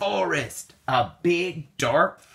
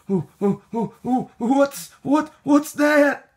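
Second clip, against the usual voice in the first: higher and louder, stammering in fright; frightened; 11 semitones higher, 4 dB louder than usual. Acted. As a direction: panic rising as you spot something. Something moves in the cave.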